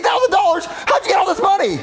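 high-pitched astonished cry; astonished outburst. Acted. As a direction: blurt it out, amazed at the windfall. Astonished at sudden big money.

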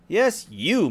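lightly mocking stepmother. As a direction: say it with a sneer. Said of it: "mocked the stepmother".

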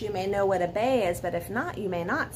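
usual speaking voice

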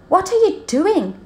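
high, bright questioning voice; curious zebra. Acted. Zigzag Zebra asking the gazelles, high curious voice. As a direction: ask like a shy little zebra. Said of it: "asked Zigzag Zebra".

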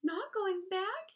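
high, loud, drawn-out cry of dismay; alarmed cry. A narrator's reading. Wilbur to Charlotte on not going back, alarmed cry. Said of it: "he cried".

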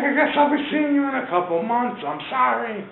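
high imitated wail; mock plaintive goodbye. Arrested Charlie bids farewell, high mock lament. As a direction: call out an apology in a put-on high voice.

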